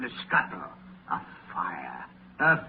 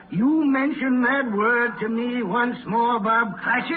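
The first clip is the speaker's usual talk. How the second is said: threatening; 8 semitones higher, 4 dB louder than usual. Acted. Scrooge threatens clerk.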